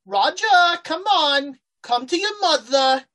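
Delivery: put-on high, loud British-accented mother voice; monster mother's call. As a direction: summon them loudly up high, British mama monster. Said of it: "you're not hearing this voice".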